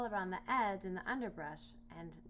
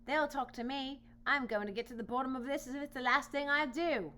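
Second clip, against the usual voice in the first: higher, louder boar vow; determined boar; 6 semitones higher, 6 dB louder than usual. Acted. Boar vowing to get to the bottom of it. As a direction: vow it stubbornly.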